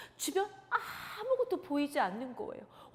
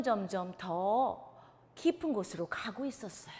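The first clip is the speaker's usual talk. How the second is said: ordinary talk, nothing marked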